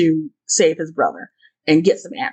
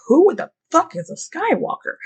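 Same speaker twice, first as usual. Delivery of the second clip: baffled outburst; 10 semitones higher than usual. Retold or imitated. Listener baffled by name.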